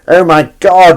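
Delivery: loud, high cry of real disgust; disgusted outcry. Real, not acted. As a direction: exclaim loudly, utterly revolted. Reacts in disgust to something seen.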